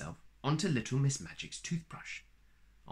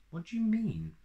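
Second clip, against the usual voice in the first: put-on high girlish question; 9 semitones higher than usual. A narrator's reading. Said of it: "asked Little Miss Magic".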